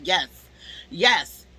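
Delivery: normal talking voice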